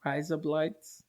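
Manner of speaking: softly trying an Australian "razor blades"; quiet, tentative Australian accent; Australian accent attempt. Shown as a demonstration. Co-host copying the trick, quiet Australian accent.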